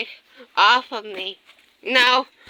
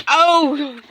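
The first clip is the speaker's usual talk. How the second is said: ordinary delivery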